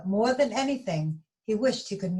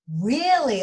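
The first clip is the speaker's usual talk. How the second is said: relieved; 5 semitones higher, 6 dB louder than usual. Acted. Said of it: "with a huge sigh of relief".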